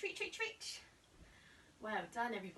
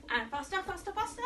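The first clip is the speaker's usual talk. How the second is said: rising urging; 7 semitones higher, 3 dB louder, faster than usual. Shown as a demonstration. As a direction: voice climbs higher with each faster. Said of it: "coaching faster jogging".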